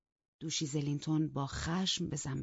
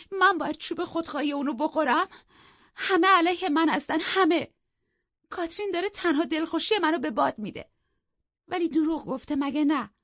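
high, loud distraught wail; acted sobbing; 11 semitones higher, 7 dB louder than usual. A narrator's reading. Isabella lamenting that everyone is against her, acted sobbing. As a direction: cry out the injustice, pitched right up. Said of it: "ایزابلا حقق کنان گفت".

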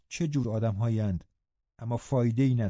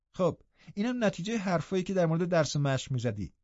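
higher voice, teasing disdain; mocking young invalid cousin; 6 semitones higher, 4 dB quieter than usual. Cousin blaming the lad's laziness and accent, mocking. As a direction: needle him as a spoilt youth.

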